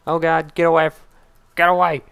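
loud, high panicked shout; panicked yell. Real, not acted. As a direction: yell at them to back off. Panicked yelling at enemies.

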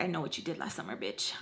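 soft menacing taunt; mock-creepy hushed text; imagined threat, hushed. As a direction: murmur it like a secret threat.

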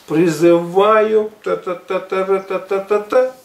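ordinary talk, nothing marked

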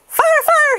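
very high, loud false alarm; high loud fake alarm call; alarmed shout. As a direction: yell fire to scare the birds. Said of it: "he cried".

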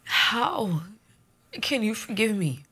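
ordinary delivery

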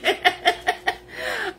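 a very high, squeaky laugh; high squealing laugh. Real, not acted. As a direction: squeal with delight. Laughing at chat comment.

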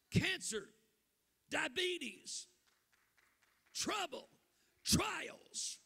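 loud, high, shouted preaching to the church; preaching at full force. Acted. Preacher to the congregation, in a loud shouted preach. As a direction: thunder it at the congregation.

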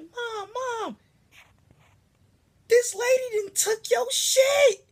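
very high, wailing mock panic; hammed-up squealing alarm, dragged out; panicked kid. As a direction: wail for mom like a frantic child. Child alerting mom to the theft.

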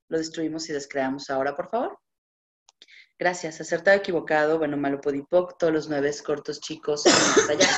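smooth, practised litany of words; ritual clearing recitation. Acted. Facilitator reciting the clearing statement to her audience, steady chant.

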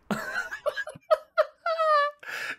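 amused, helpless giggling; laugh. Real, not acted. Reactor amused by people's reactions to the songs, giggling laugh. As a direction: break into a run of giggles.

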